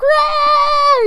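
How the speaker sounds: high, long imitation screech; hammed-up anime battle scream; mock scream. Comparing this guy to Naruto, high mock scream. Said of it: "He just screams".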